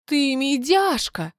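contemptuous, drawn-out name-calling; scornful snort. A narrator's reading. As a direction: sneer the insult with relish. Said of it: "фыркнула Марта".

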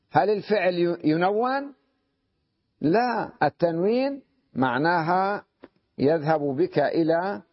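usual speaking voice

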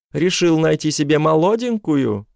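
high indignant woman's voice; ex-wife voice. Acted. Ex-wife's texts voiced.

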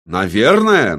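loud, indignant echo; starting to seethe. A narrator's reading. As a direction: throw the word back with rising anger. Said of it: "начал закипать здоровяк".

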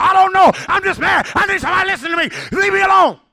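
very high, full-force angry yelling. Acted. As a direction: explode like a youth who can't talk normally. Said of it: "you raise your voice".

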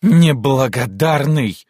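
high, loud, slow outrage; deeply indignant cry. Acted. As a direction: hurl it out in indignation. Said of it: "крикнула она с глубоким возмущением". (возмущённый крик)

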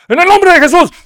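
preacher's mock exorcism shout; commanding exorcist. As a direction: cast the demon out with full authority. Apostle shouts command again.

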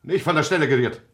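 loud, sharp order to stay; commanding shout. Acted. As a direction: stop her at the door with your voice. Father stops daughter leaving.

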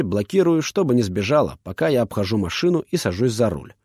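ordinary delivery

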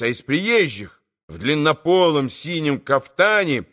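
ordinary delivery

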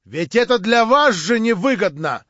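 loud, high warning shout; calling after someone. A narrator's reading. Verkhovensky calls after Shatov as he leaves, shouting. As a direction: shout the name, then press the warning. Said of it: "загадочно крикнул ему вслед".

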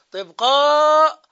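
قاض called out loud and slow, high; emphatic demonstration. Shown as a demonstration. Teacher showing students the form قاض. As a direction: stretch قاض loudly for the class. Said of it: "يلحق آخر حركة في الاسم".

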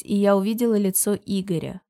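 ordinary delivery

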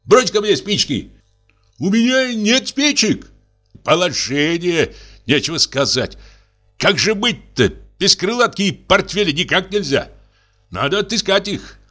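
a lightly voiced muddled drunk; flustered drunk. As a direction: bluster and fumble. Drunk husband searching wrong house.

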